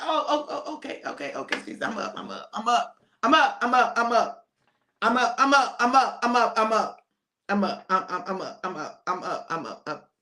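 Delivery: groggy, repetitive scramble to sound awake; startled awake. Acted. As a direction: stammer hastily as if jolted from sleep, then fade. Disciples jolted awake, insisting.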